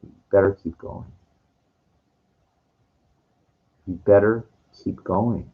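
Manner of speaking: low, soft, menacing warning; cop warning. Retold or imitated. The cop tells the night walker to move on, low and menacing. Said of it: "he looks at me and he goes".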